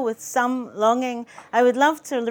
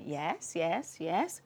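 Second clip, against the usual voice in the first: low, soft string of yeses; father's acknowledgement; 6 semitones lower, 12 dB quieter, much slower than usual. A narrator's reading. Father hearing about the woman on the telephone, low acknowledgement. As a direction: murmur agreement in a lower voice. Father's reply in acted dialogue.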